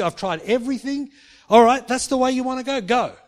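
mock exasperated brush-off; exasperated rejection. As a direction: give up on him in a loud huff. Imagined harsh God.

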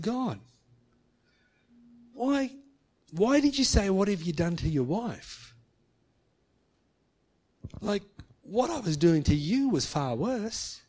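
high, pleading cry to God; mild anguished plea. Retold or imitated. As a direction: beg God for an answer, hurt. Man crying out to God.

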